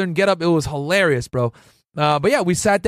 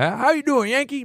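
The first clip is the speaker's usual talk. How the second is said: higher, hammed-up Texas twang; imitating a Texas Ranger's Texas accent, pitched up; 9 semitones higher than usual. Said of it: "in his Texas voice".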